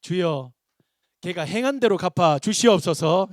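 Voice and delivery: loud, drawn-out comic plea; mock earnest prayer. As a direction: pray out loud with hammed-up fervour. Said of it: "그래서 저는 간절히 기도하고 있어요".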